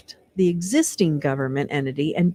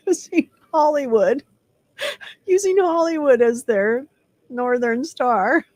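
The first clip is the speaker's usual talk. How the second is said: laughing through words; 6 semitones higher than usual. Real, not acted. Laughs at the manifesto.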